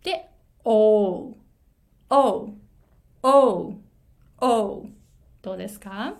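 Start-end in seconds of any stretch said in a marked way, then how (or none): 0.6-4.9 "O" started with the mouth open vertically, then glides to u; demo vowel O. Shown as a demonstration. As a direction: say "O" as a rounded o sliding into u. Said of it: "ちゃんと口を縦にして". (「O」を口を縦にして始め、うへ動かす)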